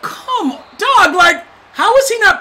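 high-pitched come on; disbelief. Real, not acted. Gamer squeals at the play in high disbelief.

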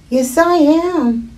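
put-on high child voice; little girl. Hungry girl answering the wolf, high child voice.

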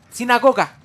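loud high eureka; excited exclamation. Real, not acted. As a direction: blurt the answer out loud. Finding the word.

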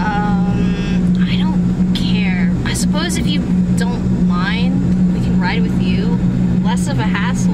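bored passenger. Acted. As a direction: shrug it off, low and unbothered. Bored passenger answering the driver.

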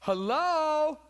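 calling out high and loud; a preacher's booming hello. Real, not acted. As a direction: shout it to wake the room. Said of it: "calling out to congregation".

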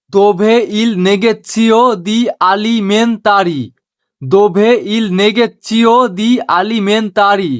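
very slow, word by word Italian phrase; slow teaching demo. Shown as a demonstration. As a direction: spell it out slowly for learners. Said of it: "এটাকে Italian ভাষায় বলে". (ধীরে ধীরে শেখানোর উচ্চারণ)

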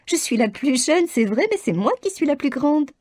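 high, confident assertion; a bold youngest sister; decided. A narrator's reading. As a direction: Lydia assures her mother she fears nothing, high and decided. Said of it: "dit Lydia d'un ton décidé".

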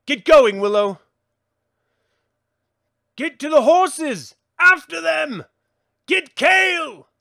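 loud, urgent battle orders; shouted commands. Acted. To comrades fleeing the soldiers, loud urgent shouted orders. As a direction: bark them over the fighting.

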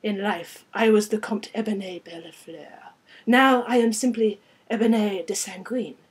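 put-on grand French-accented self-introduction; formal French-accented vampire count; rich French accent. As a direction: announce your titles in an exotic French accent. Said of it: "with a rich French accent".

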